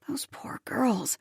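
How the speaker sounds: slow, soft whisper; whispered pity. A narrator's reading. Narrator pitying the girls, slow whisper.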